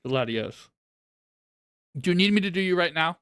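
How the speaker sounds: talking while chewing food; mouth full. Real, not acted. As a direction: say it round a bite of food. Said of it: "Sorry, I'm eating a little bit".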